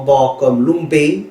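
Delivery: "lumbe" said very slowly, "mb" as one sound; slow example word. Shown as a demonstration. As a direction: stretch out "lumbe" for a learner. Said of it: "na, pa".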